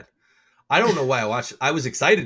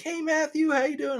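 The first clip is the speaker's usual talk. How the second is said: much higher, sing-song greeting voice; imitating the receptionist. Mimicking the dentist's receptionist greeting him, high imitation. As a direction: put on her bright welcome, pitched way up. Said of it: "And she's like".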